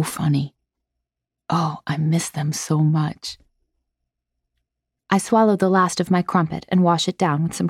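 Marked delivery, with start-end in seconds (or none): 0.0-3.4 homesick and tender; wistful. Acted. Missing family.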